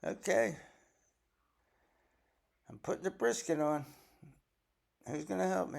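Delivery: busy, cheerful and loud; bustling hostess. Retold or imitated. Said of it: "starts going".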